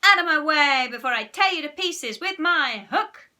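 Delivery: loud menacing pirate roar; pirate captain threat. Acted. Pirate captain threatens little brother.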